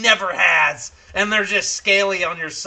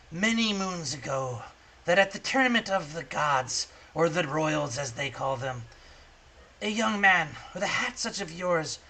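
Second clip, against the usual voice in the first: a hint of a crone, softer, confiding; old crone; 5 dB quieter than usual. Old crone questioning the boy about foresight, lightly voiced. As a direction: lean in like a sly old hag testing him. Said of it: "she says".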